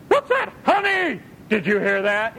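high startled mock whisper-call; hammed-up scared spouse; mock fright. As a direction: jump at a noise in the night.

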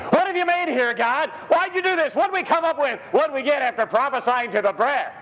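high, pleading complaint to God; mock bewildered plea; protesting prophet. Acts Ezekiel protesting to God.

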